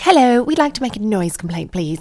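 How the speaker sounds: prim American-sounding phone call; teenage daughter calling police in a light American accent. Retold or imitated. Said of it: "more American sounding".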